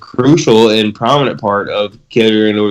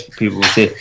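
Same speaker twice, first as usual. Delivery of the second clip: normal talking voice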